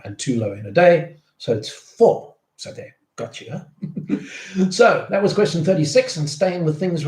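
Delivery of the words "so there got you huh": teasing whisper. Acted. Teasing viewers about a trick question.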